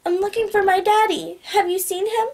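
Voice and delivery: put-on high-pitched child voice; lost, worried little girl. A narrator's reading. Girl fooling the guards, worried little girl voice. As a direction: plead like a small lost child. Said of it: "She sounds legitimately lost and worried".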